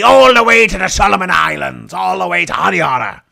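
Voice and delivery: bellowing pirate boast; pirate shout. Acted. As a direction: roar it out like a pirate captain. Pirate boasting of sailing with Queen.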